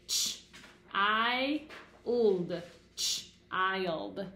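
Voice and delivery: put-on "Ch", "I", "old" said slowly part by part; sounding out. Shown as a demonstration. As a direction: sound out each chunk for the children. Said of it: "So let's read it".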